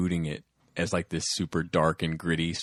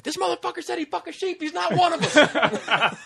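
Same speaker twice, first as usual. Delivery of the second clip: hammed-up outrage; 6 dB louder than usual. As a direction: squeal it like an outraged goofball through laughter. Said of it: "He's like".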